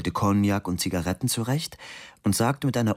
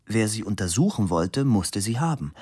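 plain reading voice

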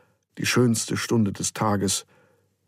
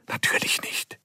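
soft unvoiced whisper under the blankets; 3 dB quieter than usual. The children whisper to each other at night, hushed whisper. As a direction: whisper it so no one else hears.

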